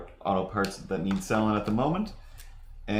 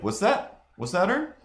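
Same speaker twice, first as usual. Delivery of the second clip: put-on high, puzzled old man asking twice; old guy squinting; 13 semitones higher than usual. Old customer squinting at the lot, high and puzzled. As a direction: play the confused old customer.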